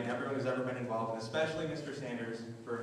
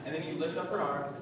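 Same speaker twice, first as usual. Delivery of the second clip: ordinary talk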